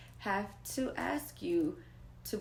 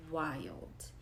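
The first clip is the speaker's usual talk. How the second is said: whispered; 5 semitones lower, 7 dB quieter than usual. Real, not acted. Disbelief at narcissist's delusion.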